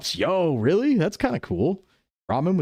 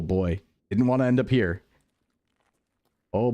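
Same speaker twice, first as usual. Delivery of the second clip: usual speaking voice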